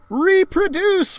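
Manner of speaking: high, slow mock class shout; shouted answer. Kids answering the teacher together, high and loud.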